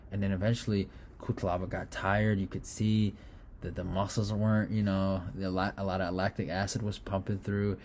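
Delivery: ordinary delivery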